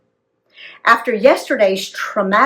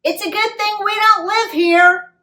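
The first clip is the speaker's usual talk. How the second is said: mock yell; 12 semitones higher than usual. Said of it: "I yell".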